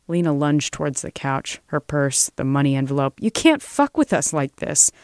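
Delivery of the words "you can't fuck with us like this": high-pitched, indignant outburst; angry protest. Acted. Lena lunges for the money, protesting angrily.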